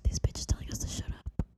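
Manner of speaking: hushed, disbelieving aside; shocked whisper. Retold or imitated. Players whispering in disbelief to each other.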